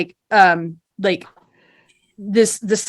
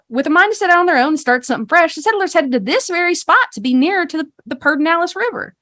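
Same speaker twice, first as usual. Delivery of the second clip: higher, lively, rehearsed guide patter; tour guide spiel; 9 semitones higher than usual. Acted. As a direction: recite a folksy town history to visitors. Game master voices guide's rehearsed spiel.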